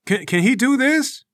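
high, stammered protest; baffled fighter. Acted. Bald fighter turning to the judge, baffled. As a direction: appeal to the referee in disbelief. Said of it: "He turns to the judge".

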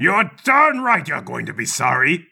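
a hint of a gloating old man; slow, pompous warning; menacing old politician. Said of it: "announces one of the old men".

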